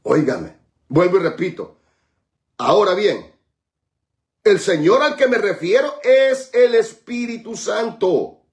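normal talking voice

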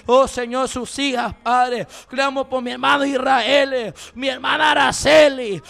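ordinary delivery

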